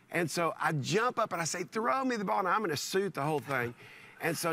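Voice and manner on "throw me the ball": mild excited call. Retold or imitated. Man in a suit jumping up for the ball, mild excited call. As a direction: lightly re-enact an eager fan's call. Jumps up and calls out again.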